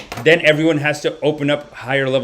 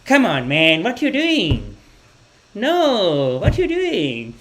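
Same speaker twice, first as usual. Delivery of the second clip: high, drawn-out uncle protest; complaining uncle impression; 5 semitones higher, much slower than usual. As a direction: mimic an exasperated uncle. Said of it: "he's like".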